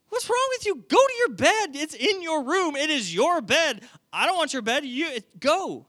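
high-pitched, incredulous outburst; exasperated parent. Acted. Dad sending child to his own bed, exasperated. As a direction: scold in baffled mock exasperation.

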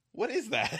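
high, puzzled what is that; baffled disbelief; surprised. Retold or imitated. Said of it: "And I was like".

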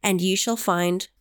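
slow, grave biblical recitation; solemn scripture reading. Acted. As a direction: intone it like ancient scripture. Reads scripture aloud.

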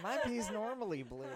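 high, laughing quote. Retold or imitated. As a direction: say it cracking up. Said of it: "I was like".

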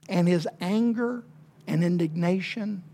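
ordinary delivery

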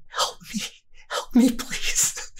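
slow, hushed plea for help; desperate little stage whisper. Acted. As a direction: whisper for help to the one beside you. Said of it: "[name] is just leaning over to Kate".

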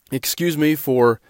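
ordinary talk

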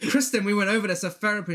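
hammed-up exasperated mum; scolding mum. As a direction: rattle it off as the nagging mother. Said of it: "her mum's like".

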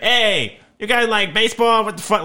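self-mocking loud friendliness; mock brash American. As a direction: barge in like an over-eager tourist. Acting himself as brash American.

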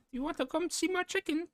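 squeaky high mock villager line; Fable villager voice. Mocking a Fable villager to friends, squeaky and high. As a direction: pitch way up like a goofy game villager. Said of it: "What do they say in Fable about the chickens?".

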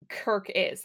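ordinary talk, nothing marked

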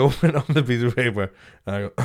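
ordinary delivery

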